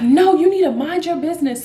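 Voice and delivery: high-pitched indignant retort; mock yelling. Mother getting in a passenger's face, mock yelling. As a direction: imitate someone snapping back, pitch jumping up. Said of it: "get in her face and yelling".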